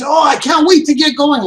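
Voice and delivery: high, loud, gushing excitement; eager buyer. Retold or imitated. Mock eager buyer gushing about starting. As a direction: play the thrilled customer.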